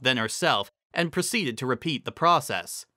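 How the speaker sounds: usual speaking voice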